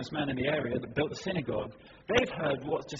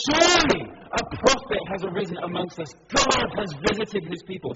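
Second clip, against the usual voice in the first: crowd acclaim; 4 semitones higher, 9 dB louder than usual. Retold or imitated.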